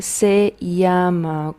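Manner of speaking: se llama drawn out slowly; slow clear reading. Shown as a demonstration. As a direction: read it slowly for learners. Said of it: "Aquí tenemos la primera frase".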